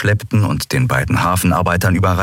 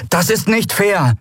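hohe Jungenstimme, empört; hoch und trotzig protestieren; Miko, trotzig. A narrator's reading. Miko schützt Andriel. (Schiffsjunge verteidigt die Kapitänin, hoch)

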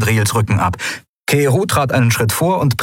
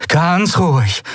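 higher-pitched warning to stay calm; cautioning; 10 semitones higher, slower than usual. A narrator's reading. Heroine edges past hostile pirates, cautioning. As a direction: hold them off with a tense caution. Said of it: "sagte Endriel".

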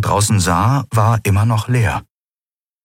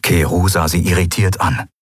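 ordinary delivery, nothing marked

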